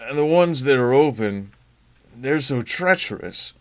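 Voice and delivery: husband on a crowded phone line, slowed; lightly voiced husband, careful and apologetic; Rand on the phone. Husband calls wife from noisy convention, lightly voiced and apologetic.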